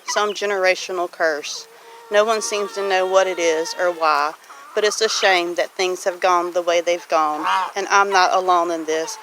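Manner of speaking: ordinary delivery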